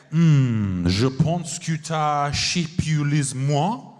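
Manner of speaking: low, drawn-out French seduction; slow, romantic French purr, hammed up; French accent. Comedian wooing someone in the audience, romantic French accent. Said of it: "how the French accent makes everything romantic".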